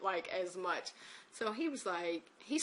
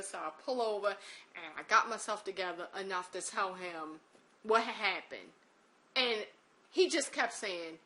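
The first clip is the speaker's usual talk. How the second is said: ordinary delivery, nothing marked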